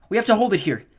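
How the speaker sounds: high, loud battle order; rally them to stand; urgent shout. A narrator's reading. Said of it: "Annabeth said".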